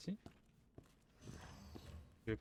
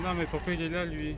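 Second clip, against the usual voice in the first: loud, higher panicked protest; panicking as the monster appears; scared horror game streamer; 4 semitones higher, 7 dB louder than usual. Real, not acted. Monster chases him, alarmed.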